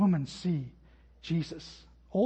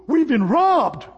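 higher, louder, slow hammed-up panic; mock alarm; 8 semitones higher, 9 dB louder, slower than usual. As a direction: play the shocked wife, all drama. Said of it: "she doesn't go".

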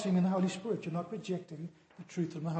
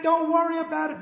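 higher, loud easygoing reassurance; carefree driver; 10 semitones higher, 8 dB louder than usual. Retold or imitated. Driver brushing off his scared friend, breezy and loud. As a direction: wave off the worry, breezy.

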